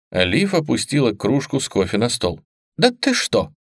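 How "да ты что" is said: high, eager disbelief; breathless excitement; excited gasp. Acted. Excited sister.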